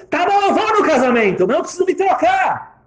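very high, loud giving in; exasperated surrender. Retold or imitated. Wife gives in to husband about wedding, exasperated yell.

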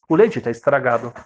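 ordinary delivery

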